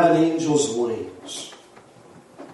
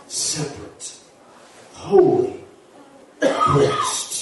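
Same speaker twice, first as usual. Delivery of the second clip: near-voiceless, spaced-out words; hushed reverence; 4 semitones lower, much slower than usual. Real, not acted. Preacher setting the wilderness apart for the church, hushed. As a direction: let each word out as a hush. Solemn pause on three words.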